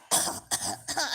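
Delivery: a dry mock cough; retold cough, lightly done; acted cough. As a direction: cough it out as if through the duppy. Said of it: "So me start".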